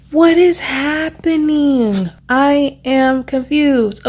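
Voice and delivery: overwhelmed disbelief. Real, not acted. Reader stunned by a twist tells viewers, slow high disbelief.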